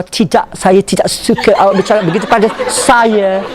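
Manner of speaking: Malay spoken with a fast British accent; Malay words in a posh English accent. Shown as a demonstration. Teacher mocks Malay spoken in a British accent. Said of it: "very British".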